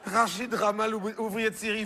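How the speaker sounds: voix remontée, nom répété avec incrédulité; répéter comme si c'était une farce; répétition incrédule. Acted. Répète le nom, croit à une plaisanterie. (répétition incrédule du nom)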